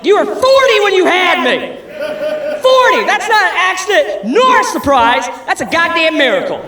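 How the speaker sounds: high, loud mock outrage at mom; mock furious yell. Son yelling at his mom over Thanksgiving, loud mock rage. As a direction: yell it back at her, half laughing.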